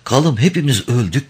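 high, anguished cry, understated sob; sobbed outcry. A narrator's reading. Son telling father of the deaths, grieving outcry. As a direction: cry it out in grief. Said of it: "diye hıçkırıyordu".

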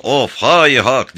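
slow drawn-out stage cry; Hacivat's cry. Acted. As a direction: call it out long, like a shadow puppet. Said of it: "diyerek sol yandan".

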